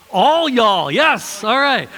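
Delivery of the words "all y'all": "All y'all" loud and high, "All" punched; emphatic all y'all. Shown as a demonstration. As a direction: boom out "All y'all" to everyone. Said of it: "an emphatic inclusive".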